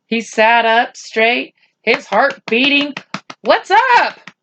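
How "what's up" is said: high, sharp startled question; a boy caught off guard, high and tense. Acted. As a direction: jump at the news, alarmed. Boy startled by mother's look.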